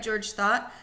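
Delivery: plain reading voice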